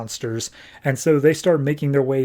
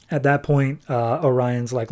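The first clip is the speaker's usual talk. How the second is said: plain reading voice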